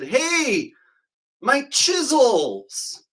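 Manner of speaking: high, very drawn-out call; hollered mock greeting. Man walking in to greet the guys, high drawn-out holler. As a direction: sing out the greeting high, stretching it slowly.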